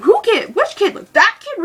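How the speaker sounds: high, loud blurted questions; really loud. Retold or imitated. Embarrassing a friend over a cute kid, loudly. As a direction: blurt it out for everyone to hear. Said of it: "really loud, and, like, point them out".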